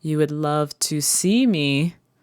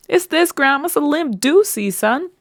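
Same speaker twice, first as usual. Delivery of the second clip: much higher, louder put-on voice; much higher silly voice answering grandma; 11 semitones higher, 5 dB louder than usual. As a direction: ham up a funny voice. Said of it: "Why are you talking like that".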